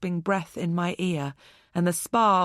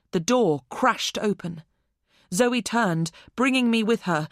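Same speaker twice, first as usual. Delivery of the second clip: plain reading voice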